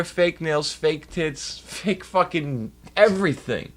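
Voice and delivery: ordinary delivery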